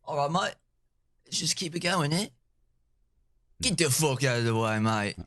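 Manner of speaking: a hint of a rough Aussie bloke telling someone to shove off; casual Australian accent, dropping low for the swearing. Shown as a demonstration. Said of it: "kinda Australian".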